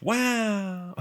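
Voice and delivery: high, delighted open wow. Shown as a demonstration. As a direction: sound amazed and open. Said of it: "see the difference in vibe".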